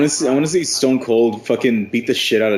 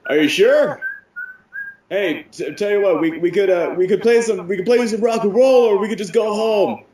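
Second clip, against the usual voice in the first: higher, coaxing the crowd; mock rock star bargaining, higher; 7 semitones higher than usual. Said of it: "he's like".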